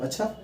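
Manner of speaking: soft अच्छा leaping high into a question; questioning tune. Shown as a demonstration. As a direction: ask अच्छा with a quick upward lift. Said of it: "प्रश्नात्मक point".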